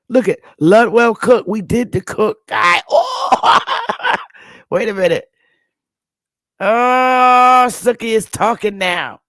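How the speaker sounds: high, loud burst of excitement; excited. Real, not acted. Finding a familiar name in the census, excited shout. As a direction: yelp with a laugh at the find.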